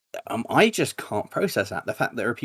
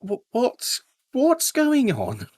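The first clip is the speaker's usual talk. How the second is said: much higher and louder, slow, laughing disbelief; baffled disbelief; 14 semitones higher, 6 dB louder, much slower than usual. Retold or imitated. Seeing viewers greet him from abroad, high, slow bewilderment. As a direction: squeak out total bafflement. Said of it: "And I'm like".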